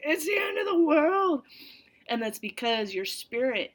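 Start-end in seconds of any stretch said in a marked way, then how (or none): 0.0-1.4 high, loud mock crying shout; melodramatic wail. Someone who dropped something, melodramatic mock wailing shout. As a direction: wail it like a total disaster. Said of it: "you're like".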